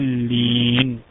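ضالين with a loud, very long held «ا»; madd elongation. Shown as a demonstration. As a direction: chant ضالين, sustaining the alif before the doubled ل. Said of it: "مثال المد اللازم". (مدّ طويل مرتّل في ضالين)

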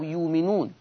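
"يؤمنون" as yuuminuun; no glottal stop in "يؤمنون", a long "uu" instead; smooth yuu-minuun for "يؤمنون"; hamza turned to waw. Shown as a demonstration. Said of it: "فهبدلها واو".

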